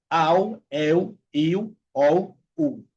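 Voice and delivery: ao, eu, il, ol, u as "au, eu, iu, ou, u"; vowel drill of ao, eu, il, ol, u, every ending rounded. Shown as a demonstration. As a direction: list ao, eu, il, ol, u each gliding into u. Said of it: "se pronuncia la L como la U".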